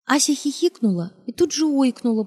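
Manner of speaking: ordinary delivery, nothing marked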